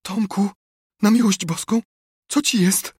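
high, anxious appeal; breathless alarm. A narrator's reading. Aunt rushing to the sickbed, alarmed. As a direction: ask urgently, frightened for the child. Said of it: "wyszeptała niemal bez tchu".

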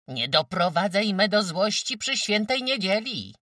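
loud, high-pitched scolding; angry warning. A narrator's reading. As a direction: warn him with rising temper. Mother warns defiant son. (gniewnie, podniesionym głosem)

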